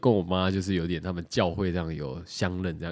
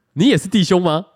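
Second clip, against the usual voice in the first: higher, louder excited quote of the elder; excited question; 9 semitones higher, 8 dB louder than usual. Retold or imitated. Retelling the elder hearing "brother", eager high question. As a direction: play the elder lighting up eagerly.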